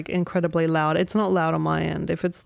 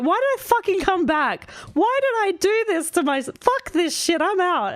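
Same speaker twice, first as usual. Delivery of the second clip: high, exasperated mock outburst; retold exasperation; 10 semitones higher than usual. Creator reenacting quitting in frustration, high mock exasperation. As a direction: throw up your hands in a pitched-up fed-up rant.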